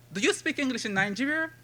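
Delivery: pitched high and incredulous, a mocking American asker; mock questioner. Poet mimics the American boy asking about English, mock high question. Poem voices the ignorant questioner.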